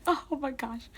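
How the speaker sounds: high, soft "fangirl" gasp; swooning fan reaction. Retold or imitated. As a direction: imitate a touched, swooning crowd. Retelling fans' swooning reaction.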